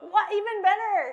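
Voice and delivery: thrilled at the upgrade; excited. Acted. Excited improv reply.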